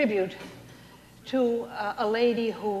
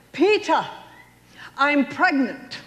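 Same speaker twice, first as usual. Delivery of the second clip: announcing loudly; 6 semitones higher, 5 dB louder, slower than usual. Retold or imitated. Calling husband with big news.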